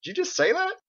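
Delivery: sudden high-pitched disbelief; surprised question. Acted. Husband asks his wife if she really said that, surprised. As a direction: squeak it out in amazement. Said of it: "He scratched his head and said".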